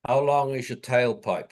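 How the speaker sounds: ordinary delivery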